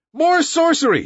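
high-pitched cry of alarm; crowd shouting. A narrator's reading. Villagers see the bullet miss Mowgli, shouting. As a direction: call it out high as a frightened mob. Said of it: "shouted the villagers".

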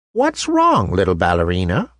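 put-on reach out gently to a lost child; gentle. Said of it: "asked a gentle voice".

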